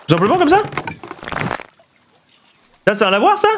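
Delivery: can it really be that simple; surprised doubt. Real, not acted. Doute du conseil du chat. (surprise incrédule, voix très aiguë)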